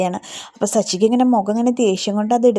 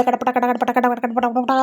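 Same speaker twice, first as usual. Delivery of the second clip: slow rhythmic spoken clatter of vessels; clattering pots noise; slower than usual. Retold or imitated. Narrator imitating Revathi slamming kitchen vessels, slow clatter. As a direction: sound out pots crashing into the sink.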